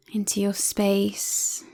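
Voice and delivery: usual speaking voice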